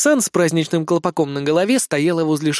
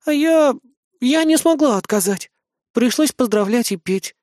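high-pitched flustered account; embarrassed idol girl; 8 semitones higher than usual. A narrator's reading. Idol girl explaining her accident to the captain, flustered. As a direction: confess it in a high girlish voice. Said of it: "смущённо отвела взгляд".

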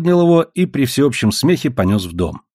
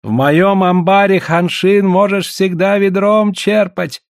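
high, slow, stretched-out call; shouting in his ear; 7 semitones higher, slower than usual. A narrator's reading. Trader shouting into the old man's ear, shouting. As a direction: shout the lure with drawn-out words. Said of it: "кричал Гао на ухо Гольду".

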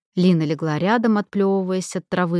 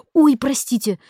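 high, louder blurted apology; startled apology; 7 semitones higher, 6 dB louder than usual. A narrator's reading. Trainee who just hit Lina with his net, startled and apologetic. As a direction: blurt it out as a sudden "oops".

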